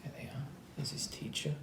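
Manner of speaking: quick whispered aside. Real, not acted. Reader showing children the teacher, whispering. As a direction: point and whisper.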